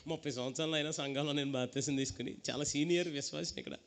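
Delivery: low, soft wheedling plea; compromising old believer. Retold or imitated. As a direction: beg God to excuse this once, in a low voice. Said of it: "రాజీ పడిపోతున్నారు".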